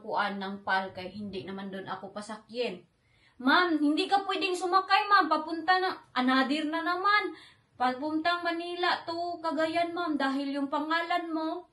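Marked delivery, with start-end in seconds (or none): 3.4-11.7 high, loud mock airline staff; high, loud airport staff refusing her boarding; rude staff voice. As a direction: play the snippy airport agent.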